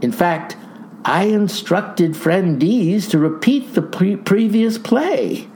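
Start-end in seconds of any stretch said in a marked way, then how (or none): none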